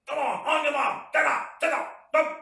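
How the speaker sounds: high, loud drill commands; army-trainer shout. Retold or imitated. Imitating army trainers rousing recruits, shouted commands. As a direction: shout orders like a warden. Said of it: "those guys shout".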